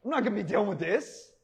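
lightly voiced flippant quitter, quick; a hint of a huffy friend walking out; mock dismissive. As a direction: toss it off like a fed-up friend leaving. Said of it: "the Holy Spirit is like".

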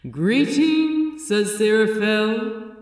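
loud, cool, commanding greeting; cool voice of the Metatron. Acted. The Metatron greeting Aziraphale from the light, cool and loud. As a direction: greet him like a great seraph. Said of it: "said the cool voice of the Metatron".

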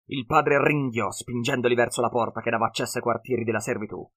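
plain reading voice

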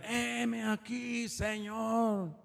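soft, high, long-drawn-out call; longed-for response. Shown as a demonstration. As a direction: stretch each word out high and soft, like a distant answer to God. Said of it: "Yo hubiera querido que dijeran". (respuesta alargada, aguda y suave)

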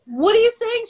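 sharp high burst of disbelief; a gasped, high what; stunned what. Retold or imitated. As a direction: jump up high, caught off guard. Disbelief at group news.

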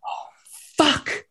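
sudden high dismayed curse; caught off guard. Retold or imitated. Caught by mom on the road.

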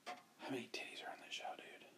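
a sneaky unvoiced whisper. Real, not acted. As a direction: lean in and whisper the question. Joking aside to his cohost.

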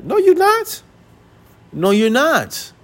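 high-pitched, drawn-out contradiction; high, disbelieving correction; incredulous rebuttal. Real, not acted. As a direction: shoot the claim down way up high. Rebutting the quoted men's claim.

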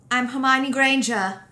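high, loud mock-British Hermione; hammy British Hermione line; attempted British accent. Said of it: "Is that British?".

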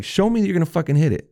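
fast, high-pitched challenge; re-enacted heated insistence; insistent demand. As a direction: push hard for proof, quick and high. Retold confrontation, demanding proof.

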